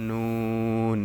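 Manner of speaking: long, steady নুন with tongue tip on palate; slow demonstration of নুন; tongue-tip letter. Shown as a demonstration. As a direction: stretch নুন out into a held n. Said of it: "উপরে তালুর সঙ্গে লাগে".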